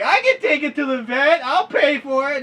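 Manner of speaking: high, loud mocking dumb voice; mock idiot. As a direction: ham up a naive do-gooder. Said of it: "an idiot would have been like".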